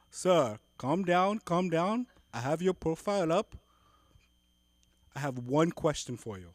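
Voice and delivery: hammed-up Indian call-centre agent; Indian accent. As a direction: play a Mumbai support rep, loud. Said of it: "he's like".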